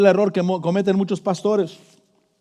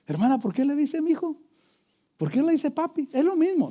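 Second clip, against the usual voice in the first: higher, lively mock questioning; counsellor questioning wife; 7 semitones higher than usual. Pastor counselling a married couple at table, higher and teasing.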